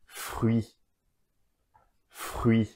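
"Fruit" the French way, final t silent; French pronunciation. Shown as a demonstration. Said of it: "final consonants are usually not pronounced".

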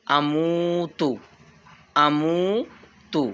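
আমুতু slow with the আ held long; stretched amutu. Shown as a demonstration. Teacher showing the alif stretch, slow. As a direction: draw out the আ in আমুতু. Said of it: "এক আলিফ টান।".

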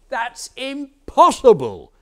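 high, slow, loud scoff; loud disbelief. Acted. Shepherd rejects the farmer's tale, loud disbelief. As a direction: draw it out loudly, voice up.

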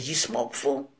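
low, soft, understated ask; low-key question. Retold or imitated. Danger asks Wicked to smoke, low-key and quiet.

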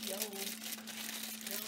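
ordinary talk

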